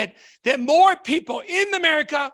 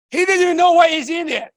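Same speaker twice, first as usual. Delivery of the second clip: shouted louder and higher on the repeat; emphatic shout; 4 semitones higher, 7 dB louder than usual. Real, not acted. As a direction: drive the point home. Repeated line, second one punched.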